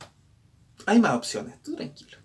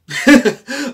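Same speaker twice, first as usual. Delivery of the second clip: carcajada aguda; 11 semitones higher, 10 dB louder than usual. Real, not acted. Carcajada fuerte.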